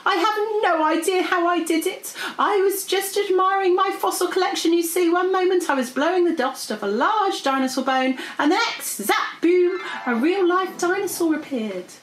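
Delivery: high, animated, dramatic retelling; distressed dragon. Acted. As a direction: recount it in a high fluster.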